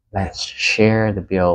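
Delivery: slow "Let's share the bill"; slow model phrase. Shown as a demonstration. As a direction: suggest splitting, slowly and clearly. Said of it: "đơn giản là". (chậm rãi đề nghị chia tiền)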